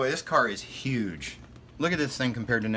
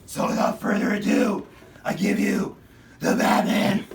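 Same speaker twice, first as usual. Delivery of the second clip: higher, louder hammed-up Batman voice; Pete Holmes Batman; 5 semitones higher, 5 dB louder, slower than usual. Unboxing the Batmobile for kids, as a hammed-up Batman. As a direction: play a boastful cartoon Batman. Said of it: "my best Pete Holmes Batman impersonation".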